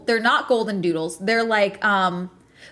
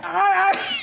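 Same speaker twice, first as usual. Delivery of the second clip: mock frightened yelp, higher with a laugh; scared yelp; 10 semitones higher than usual. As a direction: yelp like spooked big dogs. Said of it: "so they're like".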